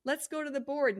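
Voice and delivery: casual friendly leader voice; light breezy tone. Shown as a demonstration. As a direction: invite her to the board like nothing happened. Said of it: "And that's how you speak".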